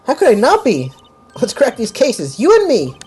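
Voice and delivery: high, eager and bright; enthusiastic. Acted. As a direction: pump up your partner. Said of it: "Aren't you enthusiastic?".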